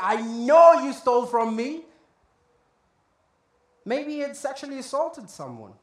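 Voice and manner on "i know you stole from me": accusing Pharisee. Retold or imitated. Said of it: "there's one Pharisee sitting there and is like".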